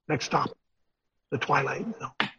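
hint of a hushed TV narrator; Rob Serling. Preacher quoting a TV show's opening, lightly voiced narrator. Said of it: "Rob Serling called it a signpost".

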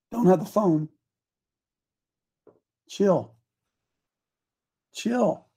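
ordinary talk, nothing marked

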